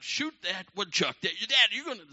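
high, loud child imitation; kids nagging Dad. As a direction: pester Dad like excited kids. Kids nagging Dad all day.

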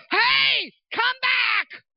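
high, full-force shout after someone leaving; imitated screaming. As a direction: holler down from high up as a panicked dad. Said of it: "Now my dad is screaming".

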